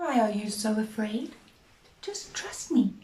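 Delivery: soft, calm and reassuring. Acted. Jesus calming his scared friends, soft calm reassurance. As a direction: soothe the frightened friends. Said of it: "said in a very calm voice".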